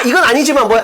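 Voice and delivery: ordinary delivery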